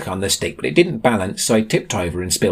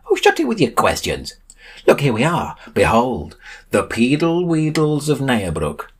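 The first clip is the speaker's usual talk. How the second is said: higher-pitched, theatrical guide; showman's raised voice, drawing out the final name; lofty Sam, impatient then grand; 6 semitones higher than usual. A narrator's reading. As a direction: brush off questions, then announce it grandly. Sam impatient, then presents the town.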